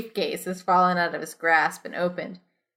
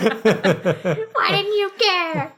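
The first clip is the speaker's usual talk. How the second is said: mock-wounded; 11 semitones higher, 5 dB louder, much slower than usual. Mock-hurt stranger voiced.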